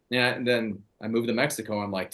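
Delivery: ordinary talk, nothing marked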